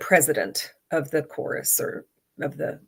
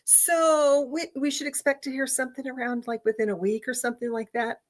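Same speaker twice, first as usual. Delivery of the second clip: mock casual; 7 semitones higher, 4 dB quieter than usual. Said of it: "casually over my shoulder say".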